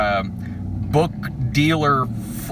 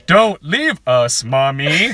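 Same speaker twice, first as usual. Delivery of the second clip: slow, pleading mock child; whiny kid; 3 dB louder, slower than usual. Child begging mother not to leave, mock whine. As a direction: plead like a wooden child actor. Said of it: "the other kid just goes".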